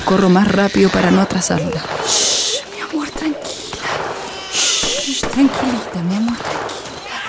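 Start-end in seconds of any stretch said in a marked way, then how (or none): 2.1-7.2 high, soft slow hush; high soft lullaby hush; hushing baby. Acted. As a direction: soothe the crying baby. Mother hushing crying baby.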